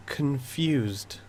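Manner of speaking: soft, breathy ghost whisper. Acted. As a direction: speak like a faint echoing ghost. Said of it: "same ghostly thing".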